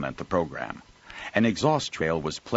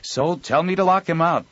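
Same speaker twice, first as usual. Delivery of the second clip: high, loud, agitated man; worked-up storesman griping; agitated Joel; 11 semitones higher, 6 dB louder than usual. Acted.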